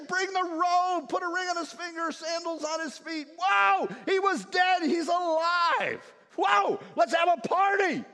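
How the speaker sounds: father orders the feast, overjoyed; exuberant father. Acted. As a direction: throw open the celebration. Father celebrates son's return.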